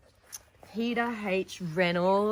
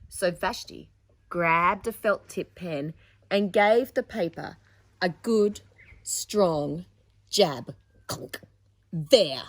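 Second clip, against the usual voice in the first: put-on louder, expressive read-aloud to children; story narration; 10 dB louder, slower than usual.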